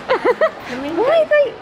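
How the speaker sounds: high, outraged squeal at prices. Real, not acted.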